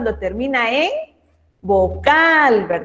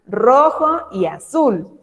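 slow emphasis; much slower than usual. Shown as a demonstration. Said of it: "teacher repeats the colours".

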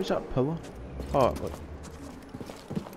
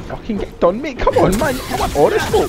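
high, loud trash-talk yell at the boss; taunting shout; 7 dB louder than usual. Real, not acted.

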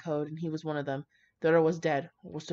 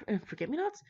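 higher, sighing, rising doubt on forget-me-nots; incredulous reread; 8 semitones higher, 4 dB quieter than usual. Real, not acted. Reader doubting the bouquet flowers, sighing and rising. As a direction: say "forget-me-nots" as if you can't believe it.

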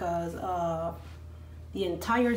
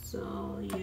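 whispered; 9 dB quieter than usual. Real, not acted. Trailing off.

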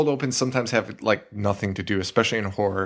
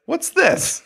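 high mock question.

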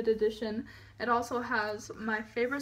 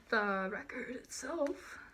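whisper; 7 dB quieter, slower than usual. Real, not acted. Careful cutting plastic.

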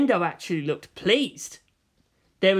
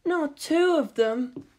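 dismayed Ritsuko; 9 semitones higher, much slower than usual. Acted. Ritsuko despairing at father and son's standoff, slow and high.

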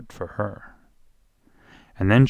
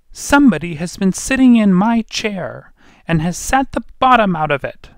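very high, loud tiny bear; small wee voice; 11 semitones higher, 8 dB louder than usual. A narrator's reading. Wee bear finds his porridge eaten, high wee voice. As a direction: squeal it out as a little wee bear. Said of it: "wee voice".

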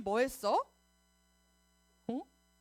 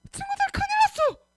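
very high, loud alarmed cries; pretend panic; 13 semitones higher, 11 dB louder than usual. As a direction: play-act a fire emergency for children. Acts out sudden apartment fire. (다급하게 비명 지르듯)

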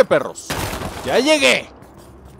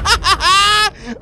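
high panicked laugh; shrieking laugh; 6 dB louder than usual. Real, not acted. Gamer laughing in panic at the monster. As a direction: laugh through the fright. Laughing scream after scare.

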